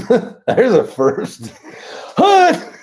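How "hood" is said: high, loud yell; shouted. Pretend. Yelling out a joke word.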